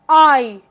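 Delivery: आई as one loud, full long-i vowel; long I sound. Shown as a demonstration. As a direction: hold आई out as a full "eye". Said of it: "इसकी long sound है".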